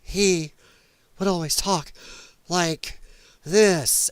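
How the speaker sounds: a pause after every few words; broken-up, word-by-word delivery; halting TV-kid impression. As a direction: imitate a boy stopping for breath mid-sentence. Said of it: "And she talks like that".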